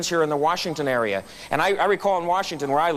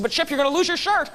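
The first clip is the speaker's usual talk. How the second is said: amazed; 10 semitones higher than usual. Retold or imitated. Customer amazed, warning Chip.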